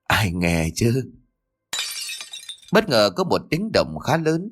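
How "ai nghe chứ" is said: slow, low eerie murmur; like a ghost calling from nowhere; ghostly voice. Acted. Eerie ghostly whisper at opening. (giọng ma quái chậm rãi)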